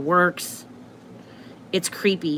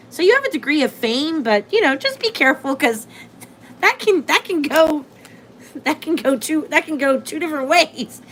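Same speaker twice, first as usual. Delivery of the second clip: higher, gently warning voice; cautioning a client; 7 semitones higher than usual. Retold or imitated. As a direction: talk like a concerned advisor. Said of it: "I'm always like".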